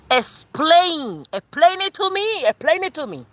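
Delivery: high-pitched, emphatic Cuban-accented English. Shown as a demonstration. Tarot reader demanding an explanation, thick Cuban accent. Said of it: "I gotta tell you with my Cuban accent".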